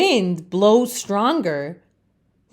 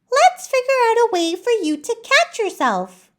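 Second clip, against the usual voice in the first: much higher, bright helper voice; a cheerful friend with a plan, pitched way up; eager friend Laurel; faster than usual. Acted. Laurel encourages Leaf, much higher voice. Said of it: "Laurel says".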